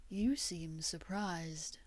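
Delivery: slow, unvoiced, menacing whisper. A narrator's reading. Gray taunts Victor in a slow menacing whisper. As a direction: purr it out slowly, barely voiced.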